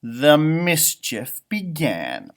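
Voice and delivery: slow, lilting read-aloud; storybook reading. A narrator's reading. Teacher reading a picture book to kids, slow and lilting.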